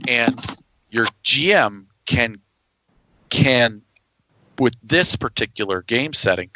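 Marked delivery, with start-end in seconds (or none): none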